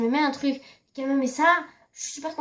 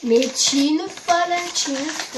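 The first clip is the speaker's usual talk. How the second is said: excited; 3 semitones higher, 7 dB louder than usual. Real, not acted. Teasing cousin about thick layers.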